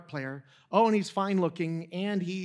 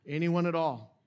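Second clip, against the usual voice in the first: teasing question. Shown as a demonstration. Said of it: "Does anybody know any other middle schoolers".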